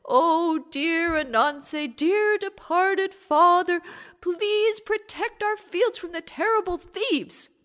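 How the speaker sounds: high, slow wailing plea to the departed father; mock solemn prayer. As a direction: pray slowly in a high, pleading wail. Family prays to Ananse.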